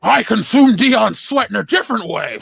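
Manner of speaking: high, loud Nixon-like horse voice; talking horse. Acted. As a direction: play a horse that sounds like Richard Nixon. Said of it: "I sound like Richard Nixon".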